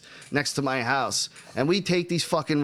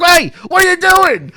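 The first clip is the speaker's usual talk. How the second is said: high loud startled yell of a man; mock shout; 9 dB louder than usual. Man runs out of burning house yelling at kids, loud shout. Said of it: "It's like".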